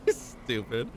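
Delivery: normal talking voice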